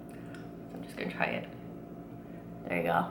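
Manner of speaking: soft hushed whisper. Real, not acted. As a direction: drop to a whisper while tasting. Quietly trying ice cream bite.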